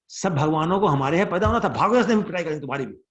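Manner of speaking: loud Hindi tirade, speeding up towards the end; exasperated scolding. Retold or imitated. Mother threatening both boys with a beating, exasperated. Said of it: "So that was Yashoda's mood".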